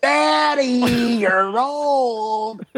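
put-on high, childish shouted "Daddy"; kid voice. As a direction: call out like a little kid. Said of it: "I'm like".

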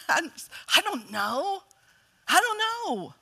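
mock defensive shrug, drawn out; lightly hammed-up dodge. Son dodging his mom's question, mock defensive. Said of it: "Right away on the defensive".

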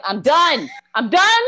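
loud, high comic disbelief; mock exasperation. Teacher floored by her students, loud mock exasperation. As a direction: throw up a high, loud protest.

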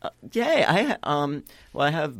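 put-on high, eager child voice; little girl voice. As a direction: squeal it like an excited kid.

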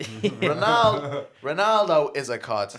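slow, loud Dunphy quote with a drawn-out Ronaldo; mock Eamon Dunphy. Quoting Eamon Dunphy to the lads, slow and loud. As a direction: stretch out Ronaldo then land on cod. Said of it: "some quotes now that you said".

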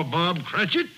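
normal talking voice

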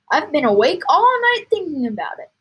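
eager father crying out; excited cry. A narrator's reading. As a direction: burst out with it, thrilled. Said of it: "he cried".

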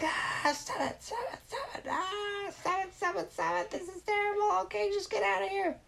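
very high, crying shrieks to stop; villain wailing at the boy to stop. Acted. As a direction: shriek and wail in defeat. Villain can't stand the name.

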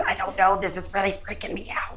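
lightly acted, uneasy confession trailing into a quick whisper; hint of a freaked-out character, hushed at the end; man in the chair. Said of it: "He says".

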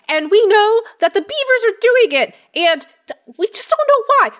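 small creature, nervous and defensive; high, quick little gnome voice, x1.5. Acted. Gnome explains about missing trees.

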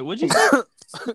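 high, slow, bewildered asking back; baffled what. Retold or imitated. Friend hearing Southern slang, asking back, slow and high. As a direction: stretch it out, high and lost. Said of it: "I'm like".

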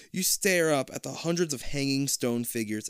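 ordinary delivery

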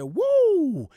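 high, loud woo; wrestler woo. Retold or imitated. Practicing the wrestler woo at home, high and loud. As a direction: howl it like a wrestler.

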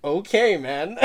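high, loud retold reaction; delighted acceptance. Student seizing the professor's easy way out, delighted acceptance. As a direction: jump at the offer, loud and high.

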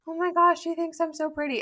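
put-on 3 gush like a girl who buys every compliment; 4 sarcastic swoon over a compliment; 1 mock flattered gush.

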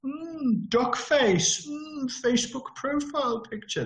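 high, drawn-out "mm" with mock posing; put-on pouty, high-pitched mockery; mocking duck face. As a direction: preen in a silly high voice. Mocking posed profile pictures.

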